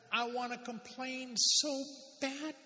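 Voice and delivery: high, slow whine on "I wanna complain so bad"; mock whining. Preacher playing the grumbler to the congregation, mock whine. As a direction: stretch it out like a sulky child.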